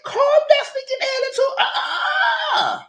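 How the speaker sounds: very high, shouted mock scolding; sassy scolding. To the castmate, a very high mock scold. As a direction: put her in her place, squealing. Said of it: "I said".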